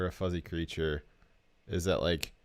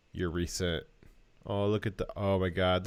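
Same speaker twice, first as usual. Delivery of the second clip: ordinary delivery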